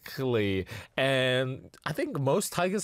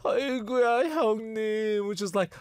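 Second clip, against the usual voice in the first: pleading wail; 7 semitones higher than usual. Retold or imitated. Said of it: "he decided to be like".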